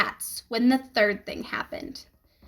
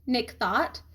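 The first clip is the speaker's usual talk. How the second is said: usual speaking voice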